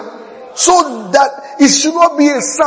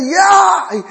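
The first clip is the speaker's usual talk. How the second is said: excited yell; 9 semitones higher than usual. Retold or imitated. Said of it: "he's excited".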